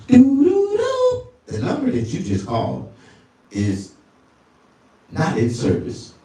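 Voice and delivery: tones then a flat operator voice; phone recording impression. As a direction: play the out-of-service message. Mimics disconnected number recording.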